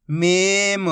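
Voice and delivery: whole word in one joined, drawn-out piece; teacher's blended model reading; syllables blended. Shown as a demonstration. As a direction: join the sounds into one clear word. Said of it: "आपस में मिला के पढ़ना है".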